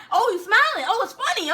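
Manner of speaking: high, loud mocking bark; taunting sergeant. Sergeant catching recruit smiling, loud sarcastic yell. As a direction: imitate a sergeant pouncing on a smile.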